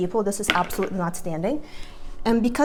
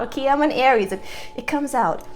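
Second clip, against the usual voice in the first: ordinary delivery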